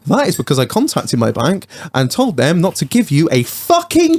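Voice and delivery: building from measured to high, drawn-out outrage; mock indignant rant. Telling the company off in an email reply, mock indignant. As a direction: stretch the insults out, pitch climbing high.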